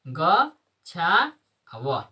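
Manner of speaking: गच्छाव drawn out very slowly, every syllable held; slow pronunciation demo. Shown as a demonstration. Teacher to class, modelling the word very slowly. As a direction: stretch गच्छाव out long so each sound is heard. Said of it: "शुद्ध उच्चारण करना है".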